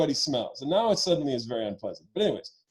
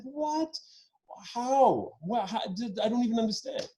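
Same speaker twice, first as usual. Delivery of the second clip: higher, stammering confusion; baffled; 6 semitones higher than usual. Retold or imitated. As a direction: sputter in mock bewilderment. Confused at being turned back.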